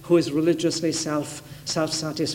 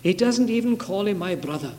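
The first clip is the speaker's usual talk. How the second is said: usual speaking voice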